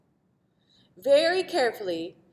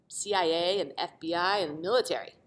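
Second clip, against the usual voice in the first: ordinary delivery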